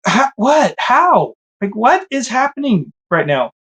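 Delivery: high, stunned what and how; shocked disbelief. Retold or imitated. Watching his wife collect offering, stunned high disbelief.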